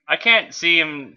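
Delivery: ordinary delivery, nothing marked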